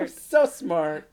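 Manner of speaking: hammed-up smug brag; smug, slow mock boast. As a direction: stretch out so smart proudly. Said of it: "you're so cute and smart and quirky".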